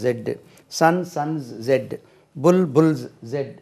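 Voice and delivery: ordinary talk, nothing marked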